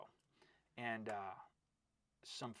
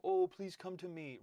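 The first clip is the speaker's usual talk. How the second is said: higher, louder imitation begging; mock pleading; 7 semitones higher, 5 dB louder than usual. Coach scoffing at recruiters who beg kids, mock pleading.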